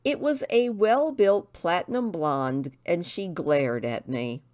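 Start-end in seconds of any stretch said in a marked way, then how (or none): none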